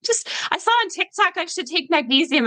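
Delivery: high-pitched imitation of an eager follower; lightly mocking, high voice; eager TikTok follower. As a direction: mimic someone keen on a trend, pitched well up. Voicing excited supplement-curious social media followers.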